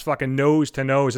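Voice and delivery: ordinary delivery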